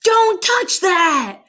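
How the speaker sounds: high, loud, drawn-out warning shout; alarmed yell. A narrator's reading. Father warning Cheryl off the candy bar, yelling. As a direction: yell each word slowly in alarm. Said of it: "Her father yelled".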